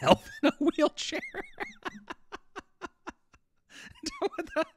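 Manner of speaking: high-pitched laughing disbelief; squeaky laughing what the hell. Real, not acted. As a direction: crack up mid-sentence and barely get words out. Cracking up at the art.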